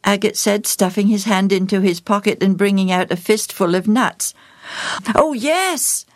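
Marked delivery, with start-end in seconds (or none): 5.1-6.0 put-on high, breathless boy's reply; panting. A narrator's reading. Joel accepts nuts as bait, panting. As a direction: answer eagerly, out of breath. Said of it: "Joel panted".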